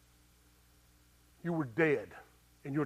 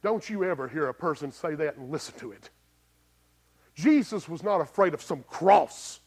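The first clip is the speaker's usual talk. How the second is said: ordinary talk, nothing marked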